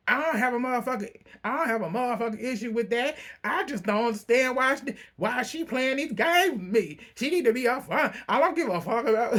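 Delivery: high-pitched, fast mock outburst; indignant rant. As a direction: act out someone fed up and swearing. Voicing Lisa's angry outburst.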